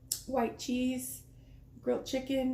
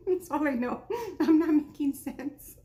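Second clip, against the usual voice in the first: laughing; 5 semitones higher, 4 dB louder than usual. Real, not acted. Laughs at own rambling.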